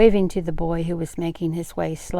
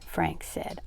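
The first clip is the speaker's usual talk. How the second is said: plain reading voice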